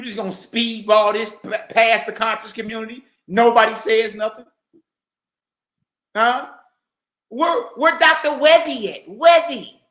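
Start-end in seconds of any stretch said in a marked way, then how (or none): none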